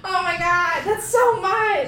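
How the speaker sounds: high, loud "oh my God"; alarmed cry at the amount; shocked. Real, not acted. Sees big hot sauce drop.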